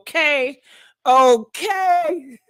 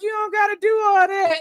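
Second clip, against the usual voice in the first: coy protest; 7 semitones higher than usual. Retold or imitated. Her coy reply to scolding man.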